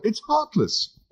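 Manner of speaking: lightly voiced squeamish Englishman; flustered Arthur. Arthur appalled.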